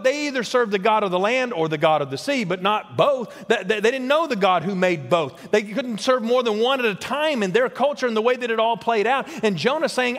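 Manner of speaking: ordinary delivery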